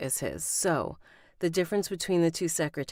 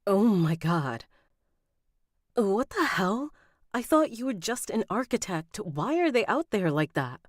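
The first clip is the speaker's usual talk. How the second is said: higher-pitched, startled disbelief; shocked; 6 semitones higher than usual. Acted. Heroine learning the architect is famous, shocked. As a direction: exclaim in alarm, pitch jumping up.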